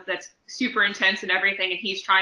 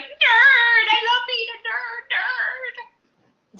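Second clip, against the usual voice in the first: very high, drawn-out gleeful cry; Stephen Colbert; 6 dB louder, much slower than usual. Acted. Talk show host geeking out at his guests, high squeal. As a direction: squeal it out like an overjoyed nerd. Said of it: "'cause he'd be like".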